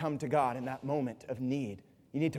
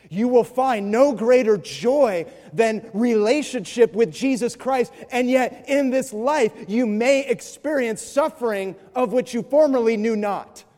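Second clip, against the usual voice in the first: loud, slow, fervent preaching; full-force preaching; 7 semitones higher, 8 dB louder, slower than usual. Acted. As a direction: drive the truth home from the pulpit.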